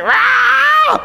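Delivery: high, drawn-out mock roar; imitated child's roar. Dad imitating his son at the program's end, high-pitched roar. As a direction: roar like a small kid going wild. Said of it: "he's just like".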